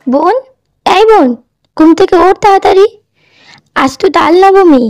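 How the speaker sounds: high, urgent calling voice; waking someone up. Acted. Elder sister waking younger one on festival morning, high and urgent. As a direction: rouse a sleeper with a high-pitched call. Sister wakes the sleeping child.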